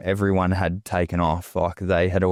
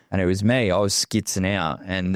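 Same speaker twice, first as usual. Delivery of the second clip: usual speaking voice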